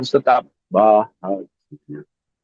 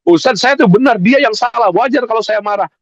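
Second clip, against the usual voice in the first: higher and louder, insisting they are right; indignant complaint; 6 semitones higher, 4 dB louder than usual. Retold or imitated. Congregant insists to the preacher that anger is justified, indignant and loud. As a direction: plead your case to the ustaz, indignant.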